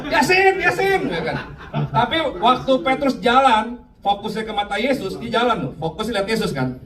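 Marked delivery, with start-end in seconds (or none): 0.1-1.0 high-pitched mock cheer; teriakan menyemangati. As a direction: call out to a friend, much higher. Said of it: "Kan kita teriak". (seruan tinggi menyemangati teman)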